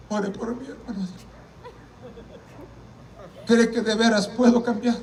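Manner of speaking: subdued imitation of crying; imitated tearful plea. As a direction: act out a hushed, weeping request. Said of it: "Estaba llorando".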